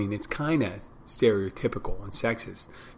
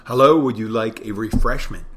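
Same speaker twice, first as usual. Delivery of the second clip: louder, welcoming server pitch; customer greeting; 6 dB louder than usual. Shown as a demonstration. Bartender offering a customer a refreshment, projected greeting. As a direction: greet the guest up front with more volume.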